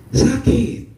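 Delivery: pained. Real, not acted. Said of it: "saya juga dilukai".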